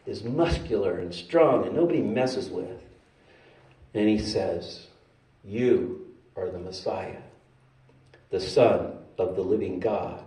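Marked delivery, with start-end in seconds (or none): 5.4-10.2 slow, low, hushed declaration; quiet, low reverence; solemn confession. Acted. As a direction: declare it softly and gravely. Simon Peter solemnly confesses.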